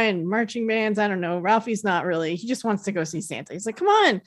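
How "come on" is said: high imitated kid urging; higher-pitched mock impatience; impatient boy. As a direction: hurry the family along like a child. Impatient boy voiced urging family.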